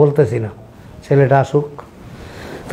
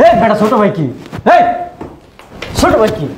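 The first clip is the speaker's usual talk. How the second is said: angry shouting; 9 semitones higher than usual. Acted. Brother shouting at brother.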